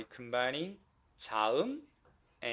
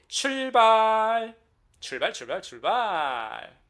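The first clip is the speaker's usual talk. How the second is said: cheerleading teacher kicking things off; rallying call; 7 semitones higher, 8 dB louder, much faster than usual. Real, not acted. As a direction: call the class to set off. Rallying the class to start.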